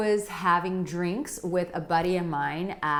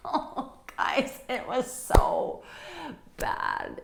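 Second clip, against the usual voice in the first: laughing through the words, voice giving out; real breathless laugh at your own disaster; embarrassed laughter; 6 semitones higher, slower than usual. Real, not acted. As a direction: squeeze it out while cracking up.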